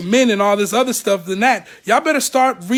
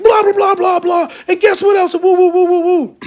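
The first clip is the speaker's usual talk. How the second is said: much higher and louder, a hammed-up shouting tirade; mock frantic ranting; 8 semitones higher, 6 dB louder than usual. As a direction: rant like you're losing it, mocking. Mocks wife's emotional ranting.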